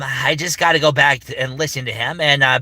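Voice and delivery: ordinary talk, nothing marked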